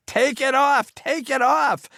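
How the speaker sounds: high desperate repeated scream, movie-style; George C. Scott scream. Retold or imitated. Director screams at projectionist like a film star, high scream. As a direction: scream it like a frantic film father. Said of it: "screaming just like George C. Scott".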